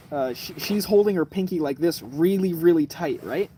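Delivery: normal talking voice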